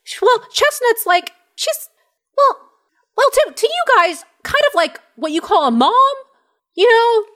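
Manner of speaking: small, high, stumbling voice; high gnome voice, hesitant. Acted. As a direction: fumble for words like a young gnome. Gnome explains Chestnut.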